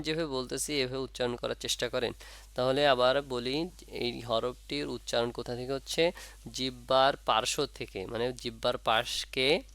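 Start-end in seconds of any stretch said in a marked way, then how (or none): none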